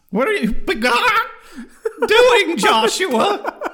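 high, laughing mom voice. Pretend. Host plays his mom answering his call, high and laughing. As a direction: mom drawing out her son's name, high. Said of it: "talking to my mom".

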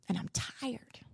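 a weary whisper. Retold or imitated. Mother confiding to her son, whispering tiredly. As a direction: barely breathe the words.

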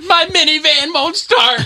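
high, drawn-out mock wail; hammed-up helpless sob story; mock distressed caller. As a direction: whine it slowly, way up high.

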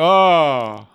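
loud, drawn-out groan like a laugh track; mock audience groan. Shown as a demonstration. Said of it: "like the groan laugh track".

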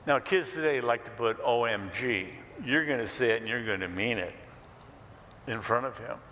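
normal talking voice

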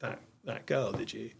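soft, hushed, slow imitation of the wife asking; wife's quiet question. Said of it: "she said".

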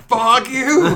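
high, very loud yell; retold furious yell. Man cursing at a cockroach he is trying to stomp, yelling. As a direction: bellow it at the top of your range. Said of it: "I'm, like, obviously yelling".

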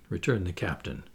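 plain reading voice

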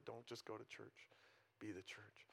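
soft, hushed punchline; hushed sermon point; quiet emphasis. Real, not acted. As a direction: drop it to a near whisper. Pastor's closing line, whispered.

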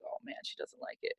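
hushed, quick worried aside; retold inner panic, barely voiced; dismayed whisper. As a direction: whisper a sinking thought to yourself. Retold dismayed thought at client's silence.

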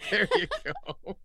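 high squeal of a laugh through the words; delighted giggling approval. Real, not acted.

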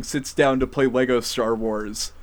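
ordinary delivery

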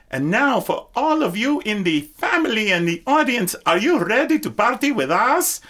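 high, loud party announcer; party hype. Retold or imitated. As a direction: whip the crowd up. Celebrity hyping the party crowd.

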